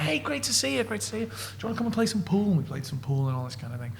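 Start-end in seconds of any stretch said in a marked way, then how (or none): 0.0-2.5 higher, soft, bright greeting; lightly voiced cheery host; chirpy Jimmy. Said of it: "He's like".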